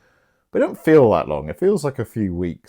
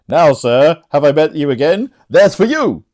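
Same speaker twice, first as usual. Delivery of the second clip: loud, raised stage delivery; theatrical attack; 6 semitones higher, 6 dB louder than usual. Acted. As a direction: build to a high striking cry. Performs Andrew's line while striking.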